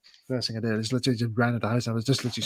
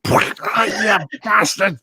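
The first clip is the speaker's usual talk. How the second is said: mock outrage; 9 semitones higher, 4 dB louder, much slower than usual. Pigeon just hit him.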